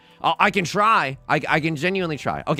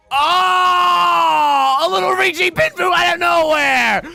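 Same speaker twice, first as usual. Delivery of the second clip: high, loud yell at a bad card; shocked yell; 11 dB louder, slower than usual. Real, not acted. Sudden bad card in game.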